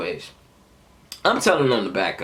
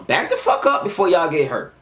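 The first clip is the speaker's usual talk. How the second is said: higher-pitched, heated warning; forceful warning; 8 semitones higher, 4 dB louder than usual. Retold or imitated. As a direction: act out warning them off insistently. Repeats the warning more forcefully.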